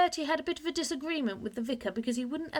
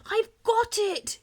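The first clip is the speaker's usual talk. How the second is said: much higher, louder, slow triumphant cry; excited cry; 12 semitones higher, 7 dB louder, slower than usual. A narrator's reading. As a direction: burst in shouting with discovery. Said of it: "he cried as he came in".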